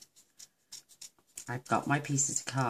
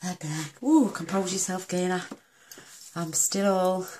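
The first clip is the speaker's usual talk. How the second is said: breathless and flustered, a bit louder; hot flush; 6 semitones higher, 7 dB louder than usual. Real, not acted. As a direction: try to compose yourself while overheated. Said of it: "it's the menopause as well".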